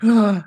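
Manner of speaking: mock collective gasp, high and loud; sharp, loud gasp imitating horrified onlookers; shocked gasp. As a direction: gasp like a stunned room. Everyone's shocked reaction, acted out.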